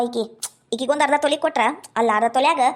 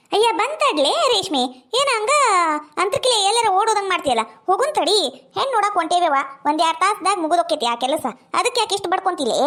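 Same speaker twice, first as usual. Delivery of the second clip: scolding neighbour woman; 4 semitones higher than usual. Acted. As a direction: chide the girl for rushing. Second woman scolds Reshme. (ಬೇರೆ ಹೆಂಗಸಿನ ಗದರುವ ಎತ್ತರದ ಧ್ವನಿ)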